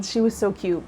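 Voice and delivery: ordinary talk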